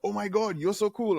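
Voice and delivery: high, gushing fan voice. Retold or imitated. DJ imitating fans gushing at him, high and bright. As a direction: mimic fans swooning over him.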